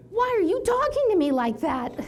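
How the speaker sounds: high, loud, offended pitch; indignant protest. Retold or imitated. She protests to her boss, high and indignant. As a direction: shoot it back, high and stung.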